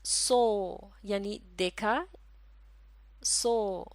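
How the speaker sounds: सो said very slowly; demo word so. Shown as a demonstration. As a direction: model सो with a long ओ. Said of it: "ये लफ्ज़ है".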